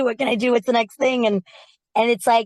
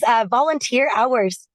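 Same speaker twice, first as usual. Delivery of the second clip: ordinary delivery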